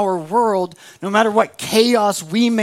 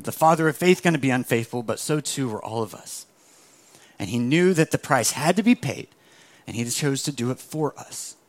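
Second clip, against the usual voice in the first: ordinary talk, nothing marked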